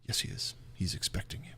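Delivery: very soft, hushed elf reply; elf servant. Acted. Elf answers the visitors' question about his master, hushed. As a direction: answer under the breath as the waiting servant. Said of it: "He looks at you and he says".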